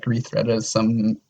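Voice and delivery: ordinary talk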